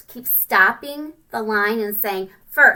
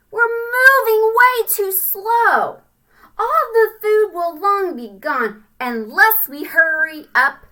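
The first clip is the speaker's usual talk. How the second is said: littlest ant voice; 8 semitones higher, 8 dB louder than usual. Acted. Littlest ant scolding the line.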